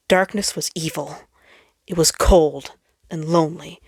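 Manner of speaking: usual speaking voice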